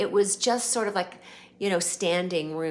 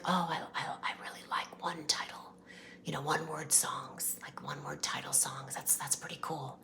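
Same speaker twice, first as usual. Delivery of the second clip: imitating [name]'s whisper; 3 semitones lower, 9 dB quieter, faster than usual.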